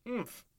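a hammed-up "oomph", pitched high; high, mock grunt. Soldier cut off by a kiss, a high mock grunt. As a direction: ham up a grunt for the listeners. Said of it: "I grunted".

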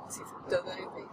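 whispered, a bit quick. Real, not acted. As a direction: mutter it in a whisper. Whispered muttering.

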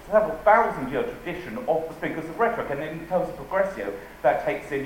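ordinary talk

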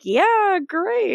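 high sarcastic deadpan yeah great; mock-bored Damon. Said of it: "And Damon's like".

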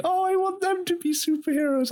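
high dreamy wish; wishful child. Retold or imitated. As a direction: plead it like a hopeful little girl. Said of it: "the payoff of Zoe going".

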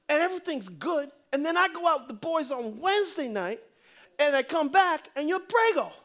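high, hammed-up Italian-American boyfriend; Joey, Italian-style. Joey confronts Mo about being pregnant, comic Italian disbelief. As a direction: build to baffled, high-pitched outrage.

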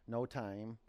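normal talking voice

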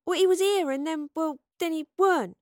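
flustered explanation. A narrator's reading. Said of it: "explained Astrid".

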